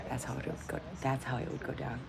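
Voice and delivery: quiet trailing repetition; a soft repeated mutter. Real, not acted. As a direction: murmur it again to yourself. Repeat softly.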